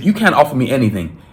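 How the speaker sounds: usual speaking voice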